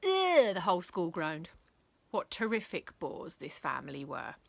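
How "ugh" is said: a big disgusted "ugh", loud and high; loud disgusted groan. A narrator's reading. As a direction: groan like a bored crowd. Whole school groaning at bores.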